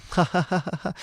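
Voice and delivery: high, soft mock laugh; quick run of put-on ha-ha-ha; imitated laugh. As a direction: fake a classmate's giggling laugh. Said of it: "And he's just laughing".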